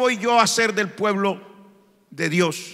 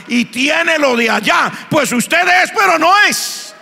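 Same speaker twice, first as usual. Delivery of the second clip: higher, louder, building sermon cry; fiery preaching; 8 semitones higher, 4 dB louder than usual. Acted. Preacher rebukes the congregation, rising to a loud preaching cry. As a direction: drive the point home to the pews.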